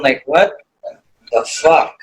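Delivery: ordinary talk, nothing marked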